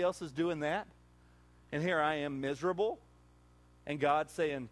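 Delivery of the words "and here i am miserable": usual speaking voice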